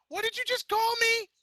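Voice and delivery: very high, outraged squeal; high outrage. A narrator's reading. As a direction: squeal in disbelief. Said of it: "Vinyl screeched".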